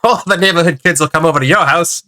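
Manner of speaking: eager vintage ad man; old-timey voice. Acted. Promising neighborhood kids will visit, old-timey pitch. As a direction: sell it like an old-time huckster. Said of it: "It's all like old-timey Jim".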